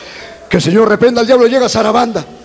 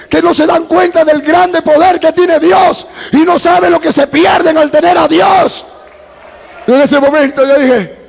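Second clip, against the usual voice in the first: high, impassioned preaching; prédica a toda voz; 9 semitones higher, 4 dB louder, slower than usual. Real, not acted. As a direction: proclaim it fervently to the congregation, pitched high. Preaches at full force. (predicando con fervor, en tono agudo)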